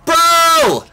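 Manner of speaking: loud high shout; frat bro yell. Real, not acted. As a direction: scream it at the screen. Big play yell.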